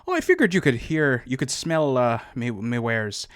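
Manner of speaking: loud, lilting leprechaun voice, settling; leprechaun peddler. Acted. Peddler answers about his spices, leprechaun voice. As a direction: play up the stage leprechaun. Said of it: "He's a leprechaun".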